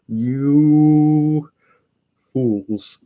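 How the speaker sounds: theatrical, put-on fury; mock outrage. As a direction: playfully scold with a drawn-out cry.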